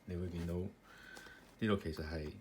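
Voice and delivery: ordinary talk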